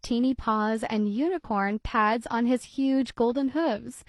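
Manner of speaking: put-on lively read-aloud voice for children; storybook reading. A narrator's reading. Narrator reading a kitty tale to children, lively storybook voice. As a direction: read it like bedtime story time. Reads picture book aloud.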